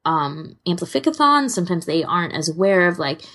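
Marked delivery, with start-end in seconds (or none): none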